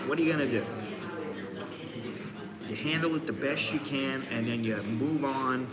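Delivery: usual speaking voice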